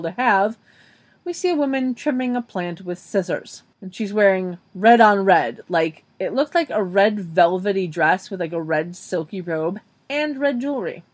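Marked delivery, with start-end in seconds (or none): none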